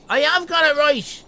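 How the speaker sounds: triumphant cry. Real, not acted. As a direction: shout it like you finally won. Delighted at bird guess.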